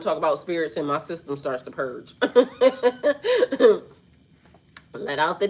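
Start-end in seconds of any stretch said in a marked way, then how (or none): 2.2-3.8 higher-pitched run of laughter; real laugh. Real, not acted. Speaker joking that spirits start a purge, real laugh. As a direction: laugh freely at your own joke.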